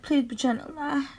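normal talking voice

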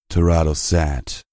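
plain reading voice

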